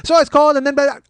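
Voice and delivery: high, loud prattle getting cut off; oblivious chatterbox. Retold or imitated. Passenger still talking as earbuds go in, oblivious chatterbox. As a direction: keep babbling right over the hint.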